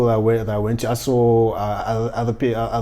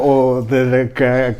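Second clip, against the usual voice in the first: normal talking voice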